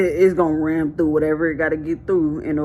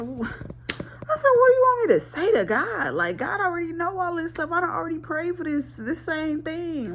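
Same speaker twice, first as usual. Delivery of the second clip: much higher, exasperated retelling of her own reply. Retold or imitated. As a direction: squeal it out, fed up.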